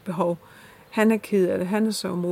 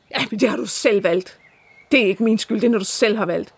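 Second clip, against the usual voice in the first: retelling his accusing tone, a bit louder; aggressive, accusing; 4 dB louder than usual. Retold or imitated. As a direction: throw the blame back at her, sharply. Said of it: "aggressiv, anklagende". (anklagende, aggressiv gengivelse)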